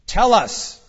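loud, high demand. A narrator's reading. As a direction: order it out forcefully. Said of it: "demanded a third".

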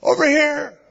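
high, loud, eager call; hammed-up delighted holler; very happy. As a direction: call out overjoyed, with a laugh in it. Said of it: "he probably said it very happily".

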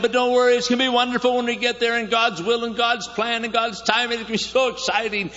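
Abraham cheering up his weary people, eager; upbeat reassurance. Retold or imitated. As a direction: sell the trip like it's wonderful.

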